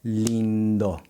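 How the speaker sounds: lindo with a nasal lin, tongue not touching; nasal vowel. Shown as a demonstration. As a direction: say lindo the Brazilian way. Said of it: "my tongue never touches the roof".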